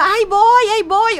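high, loud half-asleep answer; mock shouted reply. Woken woman answering the caller, high loud shout. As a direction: yell back that you're coming. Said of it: "yo gritaba".

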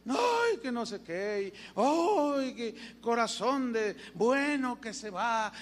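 hammed-up grief of a stranger at the coffin; mock wailing stranger. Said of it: "Y empieza".

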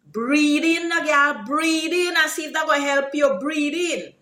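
high, urgent Guyanese Creolese coaxing; Creolese example. Shown as a demonstration. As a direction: urge someone on in Guyanese Creolese. Acts out urging someone to breathe.

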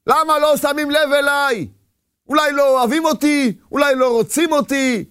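high-pitched, aggrieved questioning; rebellious protest. Acted. Man rebelling over how life treats him, aggrieved protest. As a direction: voice an inner revolt, pitched high. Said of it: "ההתקוממות הפנימית שאדם מתקומם".

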